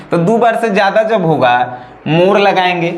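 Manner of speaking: ordinary talk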